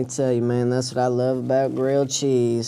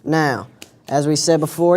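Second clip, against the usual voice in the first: normal talking voice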